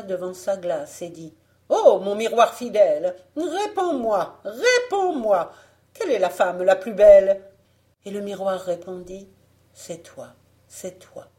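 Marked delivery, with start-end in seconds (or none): 1.7-7.4 theatrical, urgent royal demand; demanding queen. Acted. Reine presse son miroir. 9.7-11.3 quiet, mysterious oracle reply; hushed magic mirror. Acted. Réponse du miroir magique.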